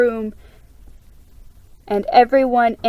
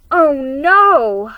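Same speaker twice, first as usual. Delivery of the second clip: louder, higher cry of dismay; miserable; 4 semitones higher, 9 dB louder than usual. A narrator's reading. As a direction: let the dismay burst out. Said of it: "sounding miserable".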